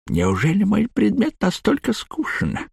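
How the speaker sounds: high, mumbling chewing voice; squelching flesh creature. A narrator's reading. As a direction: mumble as if chomping food. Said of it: "невнятно, словно чавкая". (невнятно, словно чавкая)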